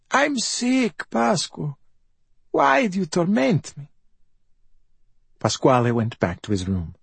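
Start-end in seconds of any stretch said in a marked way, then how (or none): 0.1-3.9 very high, self-pitying invalid; high-pitched martyred mother; wounded mother. A narrator's reading. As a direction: play the tormented victim, high and plaintive. Mother plays victim to escape blame.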